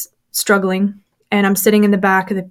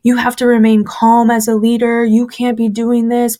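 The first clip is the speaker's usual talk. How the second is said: ordinary delivery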